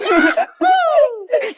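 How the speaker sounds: high-pitched giggle. Acted. Girls guessing a cellphone is inside, high giggle. Hoping the parcel holds cellphone.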